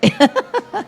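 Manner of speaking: short high chuckle; a light laugh at being jumped ahead of; amused chuckle. Real, not acted. Preacher teasing the congregation for finishing the verse, amused chuckle.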